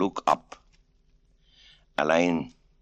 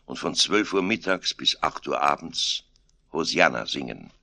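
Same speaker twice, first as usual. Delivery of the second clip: ordinary delivery, nothing marked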